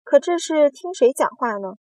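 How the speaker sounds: high, loud demand; loud protest. A narrator's reading. Centipede protesting the interruptions, loud. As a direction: demand the floor loudly. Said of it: "蜈蚣大声说".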